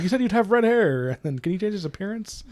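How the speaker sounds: gleeful taunt. Retold or imitated. The entertained villain mocking John, high and gleeful. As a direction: needle him like it's a show. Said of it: "he's like".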